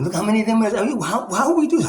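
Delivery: high bewildered pleading; lost and confused, rising high. Retold or imitated. Voicing a confused slave.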